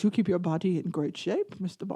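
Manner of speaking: low, soft villain imitation; imitated Bond villain. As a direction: do the villain's line low and quietly. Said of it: "Yeah, he goes".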